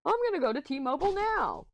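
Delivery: bright high announcement; sing-song announcement of leaving; announcing. Retold or imitated. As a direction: declare it cheerfully, up high. Announcing to mom she's leaving.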